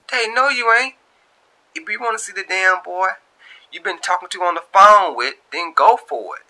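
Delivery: high, loud, sassy friend on a group call. Acted. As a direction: hype her up like a bold best friend. Friend Tia on three-way call.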